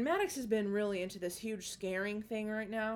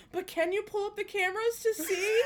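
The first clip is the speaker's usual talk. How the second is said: put-on high child voice; 12 semitones higher, 7 dB louder than usual.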